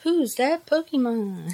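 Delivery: high, slow game-show call; Pokemon quiz voice. Pretend. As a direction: sing it out like the TV quiz. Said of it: "They might say".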